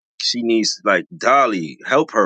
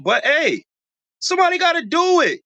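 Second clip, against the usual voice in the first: high-pitched excitement; 14 semitones higher than usual. Real, not acted. Vlogger getting excited about a fight, voice leaps up high. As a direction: voice jumps much higher. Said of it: "Y'all heard my high-pitched voice?".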